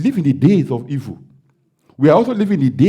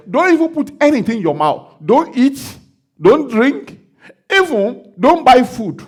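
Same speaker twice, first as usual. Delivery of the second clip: voice of God; 11 semitones higher, 7 dB louder, slower than usual. Retold or imitated. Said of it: "God now said".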